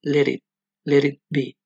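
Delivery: "Let it" with both t's tapped like Spanish r, "leri"; sing-speak "let it be" as "leri be"; flapped t. Shown as a demonstration. Teacher models the Beatles line with flapped t's. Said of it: "que es igual a la R de para".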